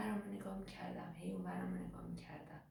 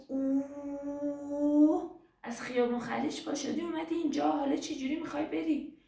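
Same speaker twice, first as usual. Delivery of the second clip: acted astonishment; 8 semitones higher, 9 dB louder than usual. Footballer hears how far the girl came, acted astonishment. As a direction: exclaim in raised, loud amazement.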